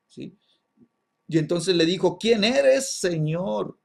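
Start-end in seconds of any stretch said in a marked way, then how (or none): 2.2-3.8 high, loud cry of a question; acted-out cry to an unknown voice. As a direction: call upward in startled appeal. Saul cries out to the voice.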